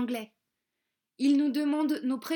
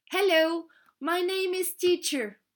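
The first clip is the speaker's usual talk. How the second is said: put-on higher, eager little girl; child's voice; 7 semitones higher, 3 dB louder than usual. Faustine eagerly answers the conductor, higher child voice. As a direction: answer like a keen small pupil. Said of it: "Faustine a levé la main".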